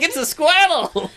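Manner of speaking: put-on high slow kid voice; childlike voice. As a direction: say it like an awed kid. Childlike mock voice.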